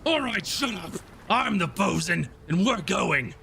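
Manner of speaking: high, exasperated bosun voice; Kurzak. Acted. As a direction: pull rank on a bickering crew. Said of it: "and then Kurzak goes".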